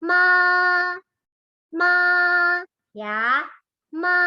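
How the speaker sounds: "ma" held high and level; first tone. Shown as a demonstration. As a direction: keep "ma" high and steady. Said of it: "Untuk nada pertama".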